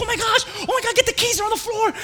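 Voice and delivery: high, loud, hammed-up alarm; frantic mock cry for help; pretend panic. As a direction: fake a brake-failure panic. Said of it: "I would pretend I couldn't break".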